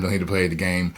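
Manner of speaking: normal talking voice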